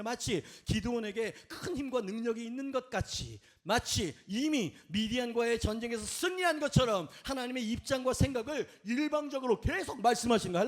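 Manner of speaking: usual speaking voice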